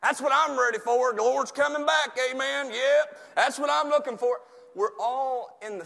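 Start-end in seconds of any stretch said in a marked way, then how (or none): 0.0-4.4 high, loud hammed-up zeal; fervent believer act. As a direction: shout it like an overexcited churchgoer. Mimics believer awaiting Lord's return.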